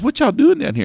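high, loud kid imitation; twelve-year-old kid. Kid quizzing tourists at the Liberty Bell, high loud mimicry. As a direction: pipe up like a nosy kid. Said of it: "he goes".

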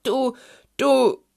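high, loud, broken stabs; fume, stuck on one word; agitated, failing attempt to scold; speechless sputtering. A narrator's reading. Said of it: "versuchte es das Schneehuhn".

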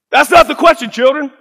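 high, loud preacher's correction; Pastor Kevin Cosby. Retold or imitated. Pastor correcting the graduates, imitated preacher shout. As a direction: call it out from the pulpit. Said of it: "Pastor Kevin Cosby".

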